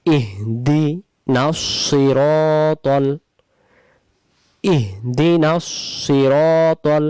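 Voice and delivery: ইহদিনাস সিরাতাল chanted slowly; slow recitation. Shown as a demonstration. Quran recitation. (ধীরে কুরআন তিলাওয়াত)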